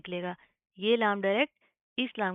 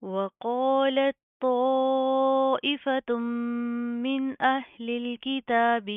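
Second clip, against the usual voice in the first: slow, drawn-out tajweed chant, louder; Quran recitation; 4 semitones higher, 6 dB louder, much slower than usual. Shown as a demonstration. Teacher chanting the verse for students in slow tajweed. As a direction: recite the verse slowly, stretching each vowel.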